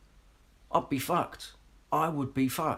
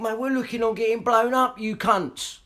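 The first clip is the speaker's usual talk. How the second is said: angry outburst; 11 semitones higher, 6 dB louder than usual. Real, not acted. Rant flares up again.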